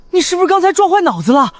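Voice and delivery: high, incredulous question; exasperated disbelief. Acted. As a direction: ask as if they've lost their mind. Incredulous at her mad plan.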